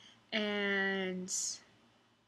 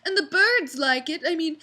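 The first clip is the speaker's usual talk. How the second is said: put-on high, louder, airy drunk chirp; imitating drunk customer; 6 semitones higher, 7 dB louder than usual. Waitress imitating the drunk woman throwing pasta, high tipsy chirp. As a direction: chirp it like a tipsy lady feeding birds. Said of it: "she's saying".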